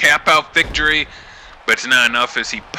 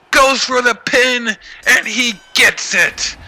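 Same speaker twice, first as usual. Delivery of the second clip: much higher, louder frantic play-by-play; excited shouting; 7 semitones higher, 4 dB louder than usual. Acted. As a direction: scream the finish as it happens. Frantic finish of the match.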